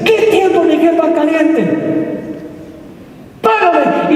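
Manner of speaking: high, heated demand; acted angry refusal. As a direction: play a creditor scornfully rejecting a plea. Creditor refusing a pleading debtor, acted.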